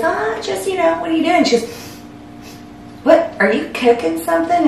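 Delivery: high, airy mock-casual mom voice; nonchalant. Mom snooping on her cooking daughter, nonchalant. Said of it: "tried to be nonchalant".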